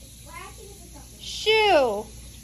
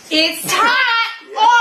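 raised, insistent shout; exasperated yell. Acted. Same warning, now exasperated.

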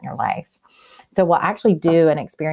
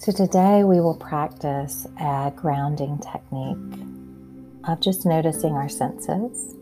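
calm grounding exercise. Acted. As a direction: guide them gently into their senses. Leads a grounding meditation.

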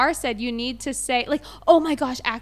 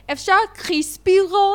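higher Israeli-accented "crispy roll"; 6 semitones higher, 4 dB louder, slower than usual. Shown as a demonstration. Host offering co-host two ways to order, Israeli accent. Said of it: "always say it with an American accent".

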